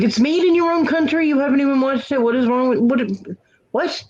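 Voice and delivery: high disbelieving rant, sputtering; exasperated. Retold or imitated. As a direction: act out mock exasperation. Retold exasperation at friend.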